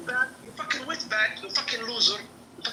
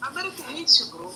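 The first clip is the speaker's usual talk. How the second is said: put-on troll's Indian accent; 5 semitones higher, 4 dB louder than usual. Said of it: "he got the Indian accent down pretty good".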